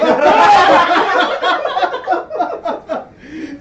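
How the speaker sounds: high, loud laughing fit; loud laughter. Real, not acted. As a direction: crack up completely. GM cracking up.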